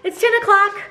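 high, startled retold exclamation; retold alarm. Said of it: "I was like".

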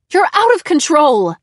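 loud, high, horrified scream. A narrator's reading. Mom horrified by the smashed lamp, screaming. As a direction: scream it at an unruly child. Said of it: "she screamed".

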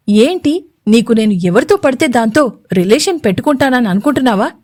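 plain reading voice

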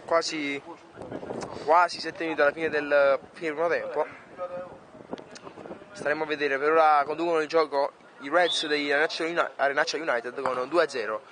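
live play-by-play commentator; sports commentary. Acted. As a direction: call the match as it happens. Match play-by-play.

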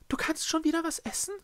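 very high little character voice; high-pitched incredulous question; Echo's creature voice. Acted. Echo asks the Schreckse about eating, incredulous.

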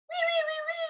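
high-pitched wiggly wees. Shown as a demonstration. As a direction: trace a squiggle with a high voice. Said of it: "We get to be all wiggly wiggly".

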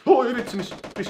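jolted by sudden gunfire; startled shriek. Real, not acted. Hearing gunshots nearby, startled shriek.

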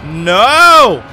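sudden loud cry of alarm; a burst of real panic, shouted; panicked shout. Real, not acted. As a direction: yell it as danger hits. Panicked shout as cars chase.

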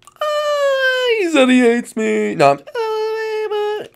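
very high, drawn-out cartoon wail; hammed-up high-pitched crying; wailing character. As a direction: whine it out in a squeaky put-on voice. Pig character wails at being hated.

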